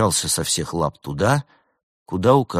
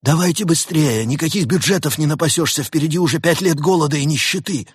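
high, loud harangue; fretful chief lamenting the expense; urgent complaining boss; 10 semitones higher, 6 dB louder than usual. A narrator's reading. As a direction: hurry them along in exasperation. Boss urges them over call cost.

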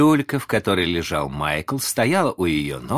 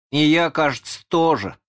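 put-on high, hesitant child voice; small boy's tentative claim; unsure; 9 semitones higher, 4 dB louder than usual. A narrator's reading. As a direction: chime in without being sure. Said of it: "сказал Майкл неуверенно".

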